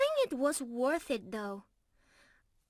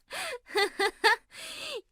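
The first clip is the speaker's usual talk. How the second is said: giggle; 11 semitones higher than usual. Real, not acted. Happy laugh at reunion.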